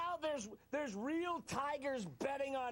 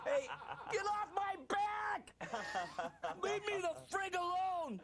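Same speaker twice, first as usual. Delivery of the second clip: mock yell; 6 semitones higher than usual. Mock rant continues.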